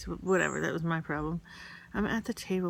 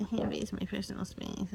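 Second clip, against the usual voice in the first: higher, softer mock voice, words squashed; mocking imitation; 3 semitones higher, 7 dB quieter than usual. Sister mocking Madeline's complaint about personal space, mocking imitation. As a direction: mimic her complaint in a silly little voice. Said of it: "would mock her".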